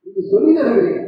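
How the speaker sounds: high, loud, driving sermon voice; fiery preaching. Acted. Preacher pressing the congregation on prayer, at full force. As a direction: hammer the point home from the pulpit.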